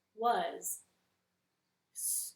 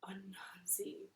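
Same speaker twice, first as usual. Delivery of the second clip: slow whispered gasp; 11 dB quieter, slower than usual. A narrator's reading. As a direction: whisper in breathless awe. Narrator whispers amazement.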